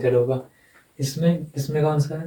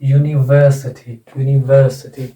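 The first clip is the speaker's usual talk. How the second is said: slow, louder "university", leaning on a long "वर"; pronunciation demonstration; 5 dB louder, slower than usual. Shown as a demonstration. Teacher showing which vowel sound comes, slow "university" with stressed long "वर". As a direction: stretch the vowel of the third syllable in "university". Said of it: "उसमें ये वाला ए आएगा".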